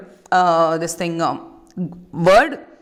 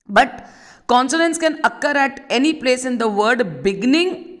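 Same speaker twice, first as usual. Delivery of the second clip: ordinary talk, nothing marked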